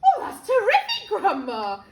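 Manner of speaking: loud, high burst of delight; excited cry. A narrator's reading. George cheering Grandma on her feet, excited cry. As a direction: cry out in amazement. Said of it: "George cried".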